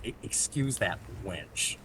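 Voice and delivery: low, soft, slow haughty drawl; snooty dismissal. Retold or imitated. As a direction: sniff it out like a snob. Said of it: "he goes".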